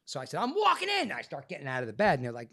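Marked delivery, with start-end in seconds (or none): 0.4-1.1 high, loud, stubborn declaration; defiant insistence. Retold or imitated. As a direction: refuse to be wheeled in. Defiant patient quoting himself.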